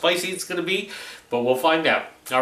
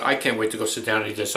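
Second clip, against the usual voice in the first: ordinary talk, nothing marked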